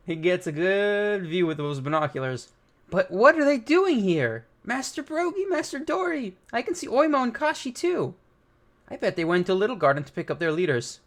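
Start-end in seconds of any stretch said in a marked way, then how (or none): 2.9-8.1 higher, excited cartoon voice; high giddy fan voice; excited Usopp. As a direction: gush with wide-eyed excitement. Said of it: "Usopp's happy".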